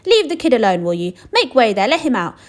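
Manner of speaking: high, loud command; loud shopkeeper. Acted. Shopkeeper orders the crowd away, loud.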